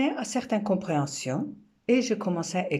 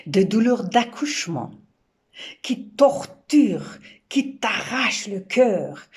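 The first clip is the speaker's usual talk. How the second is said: louder, higher, pressing through the pain; intense pain imagery; 4 semitones higher, 6 dB louder than usual. Acted. Poet voicing labour pains, loud and anguished. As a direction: push the words out like the pain tears at you.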